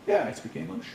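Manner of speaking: mock-obliging yes to a stranger; local answering a stranger. Local replying to newcomer on the street, obliging. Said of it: "came up to us on the street".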